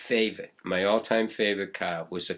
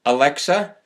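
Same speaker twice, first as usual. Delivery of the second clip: louder, higher, rising call of "Alexa"; 6 semitones higher, 10 dB louder than usual. Real, not acted. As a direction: raise the voice to wake the device. Calling the smart speaker.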